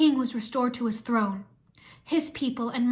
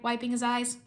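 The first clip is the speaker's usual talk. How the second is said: usual speaking voice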